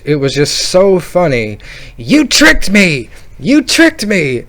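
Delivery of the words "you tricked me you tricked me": high, drawn-out mock wail of betrayal; tearful yell. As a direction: imitate a crying kid yelling an accusation. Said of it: "he's saying it while he's crying still".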